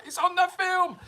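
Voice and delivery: high, shrill-edged yell at the dogs; exasperated shout. Retold or imitated. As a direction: cry out that it's only the film. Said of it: "It's like".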